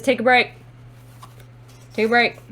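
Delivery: firm, loud order; loud command. Acted. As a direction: call out the instruction loudly. Reads the card out emphatically.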